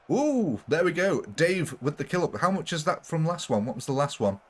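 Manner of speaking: normal talking voice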